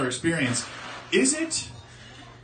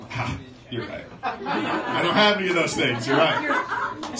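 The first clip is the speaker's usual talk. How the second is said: laughing agreement; 2 semitones higher, slower than usual. Pretend. Imagined person laughing in agreement.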